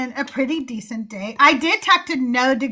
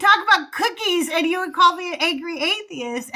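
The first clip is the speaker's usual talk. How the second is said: exasperated retort; 6 semitones higher, faster than usual. Retold or imitated. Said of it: "And I'm like".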